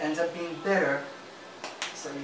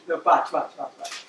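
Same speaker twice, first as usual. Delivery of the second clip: usual speaking voice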